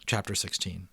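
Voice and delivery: ordinary delivery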